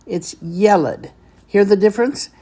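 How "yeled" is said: "yeled" said YEH-led, drawn out; stress on first syllable. Shown as a demonstration. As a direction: lean on the "ye" of "yeled". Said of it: "The accent is on the yeh".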